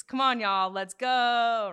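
mock hype shout, drawn out on "go"; trainer's soundbite. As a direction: imitate a workout coach calling out. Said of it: "You have a very famous soundbite".

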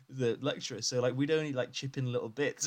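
ordinary talk, nothing marked

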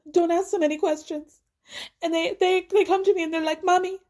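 high, pleading mock Spanish accent; thick fake Spanish accent. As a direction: imitate a mother begging in hammed-up Spanish accent. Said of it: "I feel sick doing that accent".

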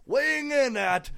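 loud, high boxing announcer; ring announcer. Pretend. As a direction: announce the fighter grandly. Wrestling ring introduction.

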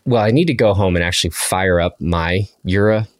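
ordinary delivery

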